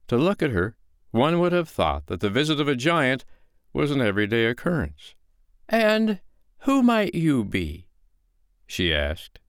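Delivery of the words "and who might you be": high, slow, lilting woman's voice; storybook woman, politely unafraid; unruffled foster mother. Acted. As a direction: ask it coolly, pitched well up. Foster mother calmly questions giant.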